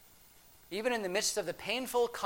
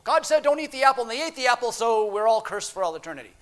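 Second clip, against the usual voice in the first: loud, high, rushed summary starting at full tilt and falling; sing-song Sunday school gabble, high and hurried; mock recital; 5 semitones higher, 8 dB louder, faster than usual. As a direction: rattle it off mockingly, loud and fast. Mocking the Sunday school version.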